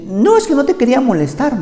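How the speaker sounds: high, innocent mock wife voice; wife explaining she didn't want to bother him; imitating his wife. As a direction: sweetly excuse herself. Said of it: "Y me dice".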